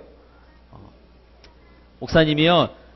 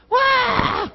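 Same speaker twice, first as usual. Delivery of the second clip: sudden high whoop of alarm; a shrill, piercing 'wa!' cry; kids shouting. Retold or imitated. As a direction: yell it like startled kids scattering. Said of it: "소리 지르면서".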